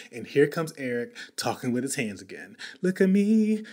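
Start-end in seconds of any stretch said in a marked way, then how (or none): 2.8-3.6 higher, louder look-at-me; excited. Acted. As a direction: show off playfully. Showing off hands.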